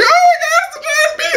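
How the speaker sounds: high, squealing mock panic at a giant bee; shocked exclamation. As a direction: shriek it in fake horror. Bee bursts out of neck.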